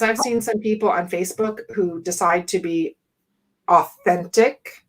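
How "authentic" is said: sarcastic authentic. Acted. Speaker mocking Facebook posters with slow sarcastic authentic. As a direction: drag out authentic slowly and low, mocking it.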